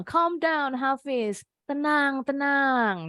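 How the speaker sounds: lightly acted motherly pleading; soothing mother. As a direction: act out a mother telling her son to settle down. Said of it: "Sama mamanya dibilang".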